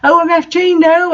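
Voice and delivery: hammed-up panic; mock scream. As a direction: scream as you fall. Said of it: "I screamed".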